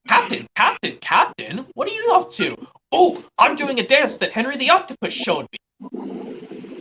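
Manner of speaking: put-on high, sing-song kids' show dialogue. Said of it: "this is gonna be a Wiggles quote".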